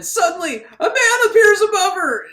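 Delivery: loud, high-pitched burst of mock suspense; dramatic alarm. Real, not acted. Recapper reveals a man suddenly above her, loud dramatic mock alarm.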